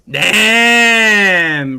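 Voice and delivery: a loud, drawn-out damn; impressed damn. Real, not acted. Streamer wowed by new skins, loud impressed damn. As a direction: blurt it out, wowed.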